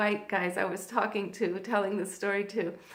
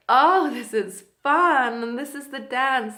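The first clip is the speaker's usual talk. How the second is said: delighted realization; 3 semitones higher, 11 dB louder than usual. Retold or imitated. Girlfriend recalls enjoying attention in Cuba, delighted. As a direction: relish the discovery as the quoted friend. Friend's fear turning to delight.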